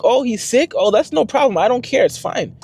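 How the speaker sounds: high, breezy dismissive put-on voice; mock careless teacher. Teacher brushing off parents about their sick son, high mocking dismissive. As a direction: toss it off lightly in a high voice.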